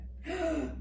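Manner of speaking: sharp high gasp of shock. Acted. Clara hearing Ma's suggestion, shocked gasp. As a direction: gasp as if scandalised.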